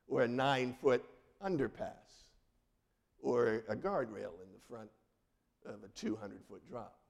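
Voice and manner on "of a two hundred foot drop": ordinary talk, nothing marked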